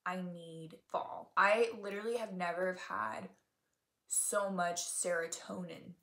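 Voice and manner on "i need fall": slow realization. Retold or imitated. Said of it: "I realized".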